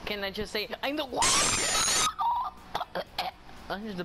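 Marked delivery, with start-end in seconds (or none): 1.1-3.6 very high squealing laugh; shrieking laugh. Real, not acted. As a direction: burst into a shrill giggle. Gloating mid-sentence.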